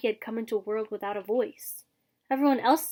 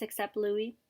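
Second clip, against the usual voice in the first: usual speaking voice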